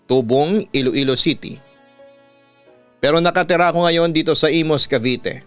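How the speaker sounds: ordinary delivery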